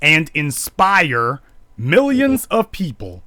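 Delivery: high, mocking read of her words; a lightly mocking imitation of the woman; mocking her statement. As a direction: parody her defiant challenge, rising high. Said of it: "Now, she goes on to say".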